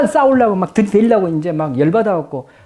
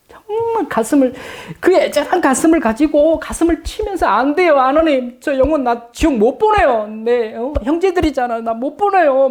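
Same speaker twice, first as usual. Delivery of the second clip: much higher, desperate pleading; a high, anguished plea for lost souls; earnest pleading prayer; 9 semitones higher than usual. Shown as a demonstration. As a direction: beg God not to let them go. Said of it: "이런 정말 간절한 마음으로 기도해 보세요".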